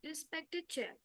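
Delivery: faint, barely audible voice; too quiet. Real, not acted. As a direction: say it far too softly into the mic. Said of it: "Your voice is very low".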